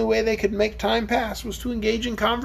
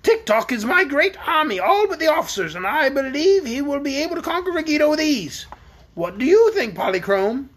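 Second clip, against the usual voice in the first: higher, lofty voice; proud queen; 8 semitones higher than usual. Acted. Queen boasting to Polychrome about Tick-Tock, lofty. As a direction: boast of the army grandly.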